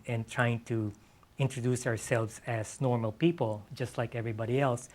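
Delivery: ordinary talk, nothing marked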